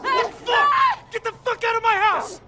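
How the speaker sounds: furious shout. Acted. Homeowner confronts intruding soldiers.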